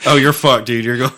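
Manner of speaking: ordinary talk, nothing marked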